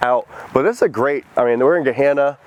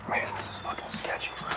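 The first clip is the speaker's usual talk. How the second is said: nervous whisper; 17 dB quieter than usual. Real, not acted. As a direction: barely breathe the words. Creeping through foreclosed house.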